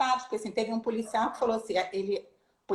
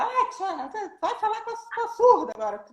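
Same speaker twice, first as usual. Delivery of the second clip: high teasing voice, laughing; mock teasing colleagues; 11 semitones higher than usual. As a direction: tease her in a high singsong. Colegas brincando com Tiana. (voz alta de provocação, rindo)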